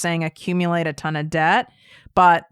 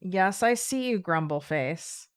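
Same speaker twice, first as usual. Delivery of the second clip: softly to the dog; 8 dB quieter than usual. Real, not acted. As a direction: hush and tease the grumbling dog. Talking to her grumbling dog.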